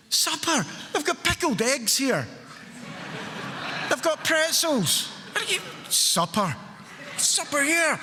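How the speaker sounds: high, incredulous mock outrage; a sneering, disbelieving parody; mocking disbelief. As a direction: scoff at them like it's absurd. Mocking the guys going home.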